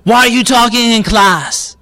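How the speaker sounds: loud, high-pitched accusing demand; scolding teacher. Acted. Teacher confronts pupil talking in class, loud scolding. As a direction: scold the pupil, wanting no answer. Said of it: "Does that teacher really care about the answer".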